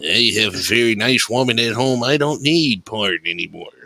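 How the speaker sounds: mock deep voice. Joking as Scott, mock deep voice.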